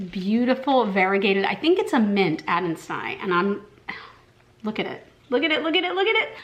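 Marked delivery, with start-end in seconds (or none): 5.3-6.4 rapid-fire excitement climbing higher; giddy, high, repeated urging; excited gushing. Real, not acted. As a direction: urge them to look, pitch rising each time. Gushing over her new plant.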